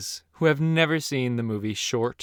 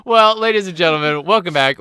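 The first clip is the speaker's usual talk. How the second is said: louder show host opener; announcer voice; 2 semitones higher, 6 dB louder than usual. Acted. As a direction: announce it to the crowd. Podcast announcer opening.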